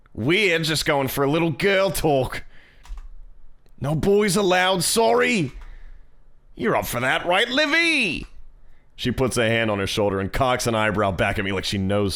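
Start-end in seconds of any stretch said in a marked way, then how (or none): 0.1-8.3 slow, high, hammed-up Australian accent; Mia. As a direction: stretch it out in bad Aussie, climbing high. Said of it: "the shitty Australian accent".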